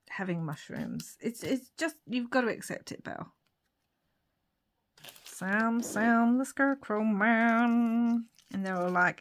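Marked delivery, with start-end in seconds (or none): 5.2-8.3 drawn-out mock-American twang; poor American accent. Naming the scarecrow for a craft story, poor American accent. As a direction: stretch it out in a bad American accent. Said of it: "my really poor American accent".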